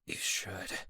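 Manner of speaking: unvoiced, hoarse and soft; barely any voice left. A narrator's reading. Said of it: "I said hoarsely".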